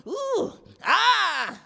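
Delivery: high, loud ooh then long yell; mock hollering. Comic mimics Junior hollering in the bedroom, loud high cry. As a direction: imitate a man hollering through a wall. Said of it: "in there hollering".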